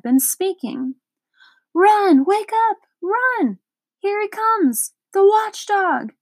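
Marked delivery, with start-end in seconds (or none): 1.7-6.1 panicked shouts. Acted. Lothargians flee the charging watchdog, panicked shouts. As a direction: shout a warning and scatter. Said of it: "Great shouts filled the air".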